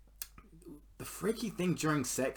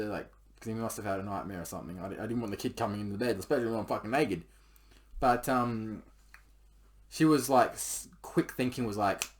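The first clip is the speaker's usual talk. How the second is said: ordinary talk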